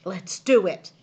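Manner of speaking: declaring. A narrator's reading. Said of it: "he declared".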